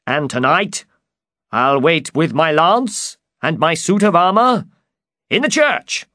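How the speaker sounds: high, eager knight voice; high-pitched storybook enthusiasm; excited. A narrator's reading. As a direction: announce the plan with rising excitement. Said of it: "said Don Quixote excitedly".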